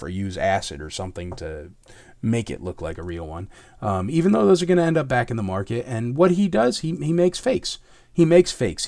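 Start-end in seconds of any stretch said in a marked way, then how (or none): 6.2-8.9 angry. Real, not acted. Angry rant about faker.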